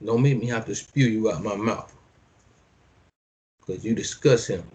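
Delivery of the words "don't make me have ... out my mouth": normal talking voice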